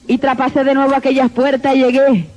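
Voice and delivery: normal talking voice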